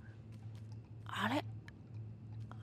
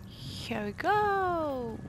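usual speaking voice

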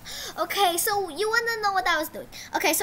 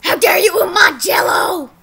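loud, higher mock officer yell; angry officer shout; 4 semitones higher, 11 dB louder than usual. Kid playing the police officer yelling at him. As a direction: shout it as the outraged cop. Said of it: "And he says".

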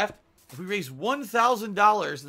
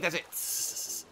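snake-laugh whisper; 4 dB quieter than usual. Shown as a demonstration. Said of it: "I laugh like a snake".

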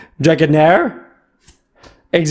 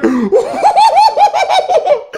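much higher hysterical laugh; shrieking laugh. Real, not acted. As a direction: burst out laughing in disbelief. Pulls Charizard EX. (éclat de rire aigu et hystérique)

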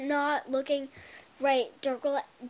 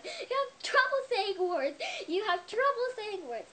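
teasing mimic; 8 semitones higher than usual. Teasing herself about fumbling words.

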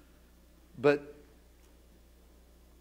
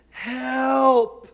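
high shouted call for help; yelled help; 10 semitones higher, 6 dB louder than usual. Acted. Victim calling out to the pastor with every ounce of energy. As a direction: yell with the last of your breath. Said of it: "you yelled".

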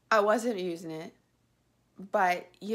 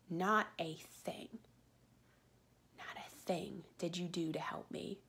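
a seething whisper aimed at the company; bitter whisper; 3 semitones lower, 9 dB quieter than usual. Real, not acted. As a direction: drop to a hard, breathy whisper. Angry rant at the hosting company.

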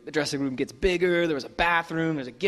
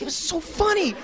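much higher, gushing mock voice; gushing impression; 13 semitones higher than usual. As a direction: squeal the praise like a giddy fan. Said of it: "[name] just starts gushing".

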